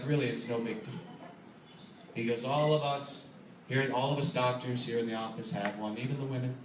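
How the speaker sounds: gentle, low bedside manner; reassuring doctor. Retold or imitated. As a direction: soothe the nervous patient. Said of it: "he was very reassuring".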